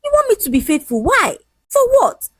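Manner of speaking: high, indignant questions; heated, high-pitched challenge; shouted retort. A narrator's reading. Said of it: "he shouted".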